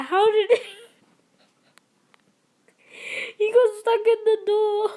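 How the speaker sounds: laughing at a player stuck in the door; laughing hard. Real, not acted. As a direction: squeeze it out while cracking up. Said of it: "I'm crying".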